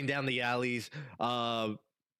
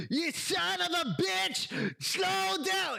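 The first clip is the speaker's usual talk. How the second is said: high, drawn-out angry yell; acted scream; 14 semitones higher, slower than usual. Game master as the pursuer behind you, high scream. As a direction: scream it high at someone running ahead. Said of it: "like, screaming".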